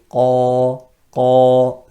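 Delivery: loud, heavy Qa. Shown as a demonstration. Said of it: "that's the sound going upwards".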